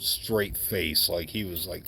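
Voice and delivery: ordinary delivery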